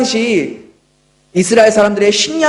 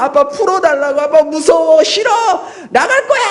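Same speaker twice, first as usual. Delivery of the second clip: put-on panicked child; 5 semitones higher than usual. Pastor voicing his son screaming in the dentist chair, panicked and higher. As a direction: scream it like a terrified toddler pinned down. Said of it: "막 그러면서 난리를 쳐도".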